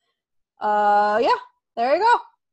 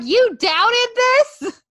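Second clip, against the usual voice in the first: mock scornful wife; 12 semitones higher, 6 dB louder than usual. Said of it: "She'll be like".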